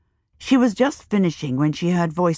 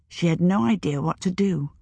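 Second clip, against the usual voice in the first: plain reading voice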